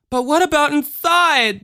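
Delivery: put-on high-pitched little-girl cry; distraught child. A narrator's reading. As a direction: cry out like an upset small child. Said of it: "the distraught child cried".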